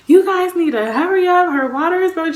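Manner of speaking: high, loud urgent demand; acted frantic urging; panicking cousin. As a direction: imitate a panicked relative hurrying the ambulance. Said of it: "She's panicking herself".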